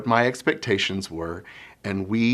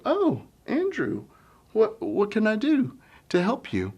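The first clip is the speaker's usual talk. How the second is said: higher, softer welcoming reply; gentle teacher; 10 semitones higher, 4 dB quieter than usual. Shown as a demonstration. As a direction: answer the child kindly. Teacher demonstrates kind response.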